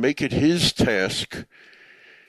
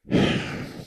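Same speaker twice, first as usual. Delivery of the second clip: a long, shaky breath out; heavy sigh. Real, not acted. Retelling a Holocaust story, overcome with a heavy sigh. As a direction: sigh as emotion catches up with you. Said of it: "I'm getting choked up about it now".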